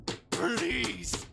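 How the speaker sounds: loud, high burst of begging; desperate plea. Acted. Creature begging at the door, loud desperate plea. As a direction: force it out as a desperate cry.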